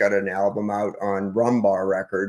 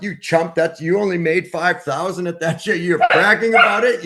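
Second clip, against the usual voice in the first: higher, louder mocking jeer; scornful taunt; 7 semitones higher, 7 dB louder than usual. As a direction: sneer at a bragging friend. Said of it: "the other half are like".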